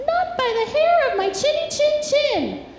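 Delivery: tiny piggy voice, high and defiant; little pig voice. Acted. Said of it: "the pig replied".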